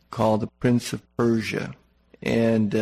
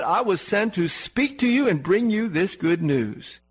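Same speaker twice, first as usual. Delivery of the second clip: raised, commanding angel voice; stern archangel; 6 semitones higher than usual. Retold or imitated. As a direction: read Gabriel's rebuke with lofty authority. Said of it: "and Gabriel says".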